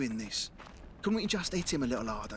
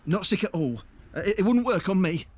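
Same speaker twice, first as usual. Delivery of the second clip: louder, rushed, scared babbling no's; panicked denial; 3 semitones higher, 8 dB louder than usual. Acted. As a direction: backpedal fast and loud, terrified of being picked. Panicked denial, offered as victim.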